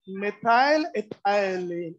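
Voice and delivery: drawn-out, syllable by syllable; slow dictation. Real, not acted. Teacher writes out the compound name for students, slow dictation. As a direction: stretch the name out slowly for note-takers.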